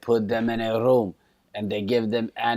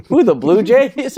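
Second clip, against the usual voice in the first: much higher, louder, slow disbelieving question; surprised passenger; 13 semitones higher, 10 dB louder, slower than usual. Retold or imitated. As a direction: squeak it out in disbelief. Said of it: "And he goes like".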